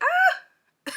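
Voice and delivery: high short mock scream. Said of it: "all of a sudden I just scream".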